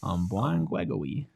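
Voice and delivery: put-on slow babyish voice. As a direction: talk like a toddler. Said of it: "Why has he got baby voice?".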